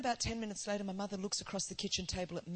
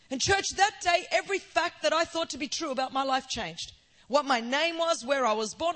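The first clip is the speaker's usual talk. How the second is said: loud, fervent preaching, higher and louder; preaching at full force; 5 semitones higher, 9 dB louder than usual. Acted. Preacher to her church, loud, fervent full-force preaching. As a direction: drive the testimony home to the church.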